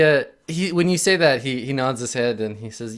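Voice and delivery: ordinary delivery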